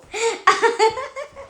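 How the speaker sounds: high, real giggling fit; high laughter. Real, not acted. Still laughing before saying she is done, high laughter. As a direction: keep cracking up. Cracks up at own bit.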